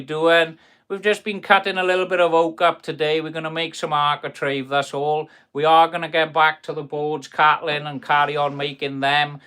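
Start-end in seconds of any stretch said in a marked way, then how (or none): none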